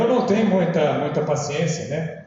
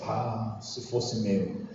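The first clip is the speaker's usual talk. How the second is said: very soft, low, slow muttered thought; inner thought; 9 semitones lower, 10 dB quieter, much slower than usual. Retold or imitated. Churchgoer thinking about someone else's screaming child, muttered inwardly. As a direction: think it to yourself, low and drawn out. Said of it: "Eu ficava pensando".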